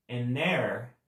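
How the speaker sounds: AN HEIR slowly, linked as "a-NAIR"; silent H. Shown as a demonstration. Teacher translating um herdeiro, slow silent H. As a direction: drop the H and join AN to HEIR. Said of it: "porque esse H é mudo".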